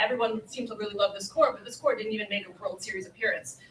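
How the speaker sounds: ordinary talk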